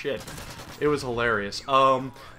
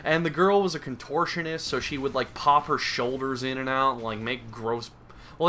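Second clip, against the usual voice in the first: ordinary talk, nothing marked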